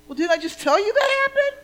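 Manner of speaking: high, fast indignant snap back; student snapping back at her teacher, high and quick; defensive retort. Retold or imitated. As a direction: bristle at the question. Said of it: "retorting to teacher in story".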